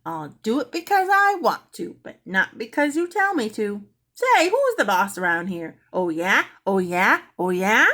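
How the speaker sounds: high, loud sassing squawk; cheeky parrot. A narrator's reading. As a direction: talk back like a smug bird. Parrot talks back again.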